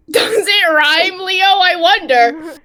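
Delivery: high, loud, slow mockery; sarcastic drawn-out tease. Narrator teases the player about the rhyme, slow and loud. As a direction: stretch it out to mock him.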